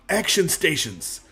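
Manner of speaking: drawn-out, deliberate call; slow, announced call to battle; slow voice command. Pretend. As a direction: stretch out each word as a command. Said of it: "No, that didn't work".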